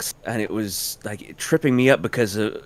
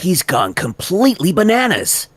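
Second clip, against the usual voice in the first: high, emphatic take; first take; 7 semitones higher, 3 dB louder than usual. Shown as a demonstration. Actor offers line variations, higher take. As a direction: push the line up and out. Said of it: "I'll give you a few of those".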